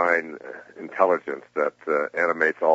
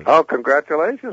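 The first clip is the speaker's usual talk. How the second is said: excited; 11 semitones higher, 8 dB louder than usual. Real, not acted. Hears of host's new grandchild.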